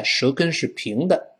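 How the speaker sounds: usual speaking voice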